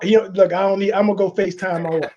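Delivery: normal talking voice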